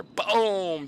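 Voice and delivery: big, loud, high boom falling away; imitated gunshot. Hunter telling of his sniper shot, loud imitated gunshot. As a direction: belt out the rifle blast.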